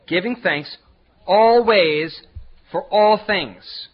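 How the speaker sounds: Giving thanks, always, for all things, each word set apart and slowed; slow emphasis. Shown as a demonstration. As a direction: lean hard on "always" and "all things", pausing between. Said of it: "repeats verse word by word".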